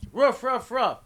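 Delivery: high yappy woofs, copying the dog; imitated dog bark.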